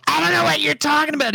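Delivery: stepmom. Retold or imitated. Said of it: "when she would go".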